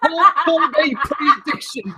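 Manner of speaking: put-on high-pitched, hammy Kiwi accent; New Zealand accent. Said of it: "that is the worst New Zealand accent".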